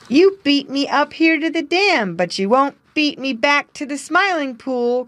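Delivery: high, teasing race challenge; playful challenge. A narrator's reading. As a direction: dare your friend to race. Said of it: "cried Jerry Muskrat".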